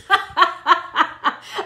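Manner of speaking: a high, real laugh; amused laughter spilling out. Real, not acted. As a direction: crack up at the comment. Laughing at a comment.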